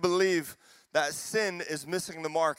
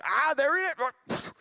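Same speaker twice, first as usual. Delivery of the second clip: high, drawn-out mock exasperation; hammed-up frustrated outburst, slow and high; mock annoyance; 10 semitones higher, 3 dB louder, slower than usual. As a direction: play an irritated God spotting a culprit. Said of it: "and he's frustrated, and he's annoyed".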